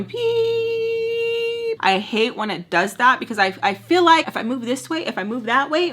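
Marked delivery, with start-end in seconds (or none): 0.0-1.8 very high, sustained thin beep; long high-pitched ringing tone; imitated ear ringing. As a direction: hold a piercing little whine. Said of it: "my ear's going".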